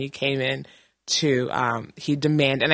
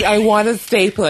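a hint of a pushy customer; mock him lightly, insistent; demanding old man; 5 semitones higher, 5 dB louder than usual.

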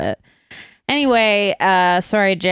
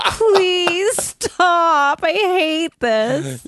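higher, pleading, slowing to a drag at the end; mock pleading partner; 9 semitones higher, much slower than usual. Partner begging her to drop the character, higher mock plea. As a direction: beg her to stop, mock-weary. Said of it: "he was like".

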